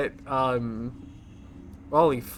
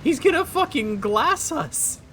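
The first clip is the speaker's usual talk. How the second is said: much higher and louder, slow panicked yell; alarmed shout; 7 dB louder than usual. Real, not acted. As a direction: scream it as the ship opens fire. Alarmed at the enemy ship.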